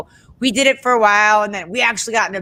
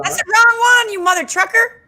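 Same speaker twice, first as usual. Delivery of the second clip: mock scolding; 13 semitones higher, 3 dB louder than usual. Real, not acted. Playful scolding of host's mistake.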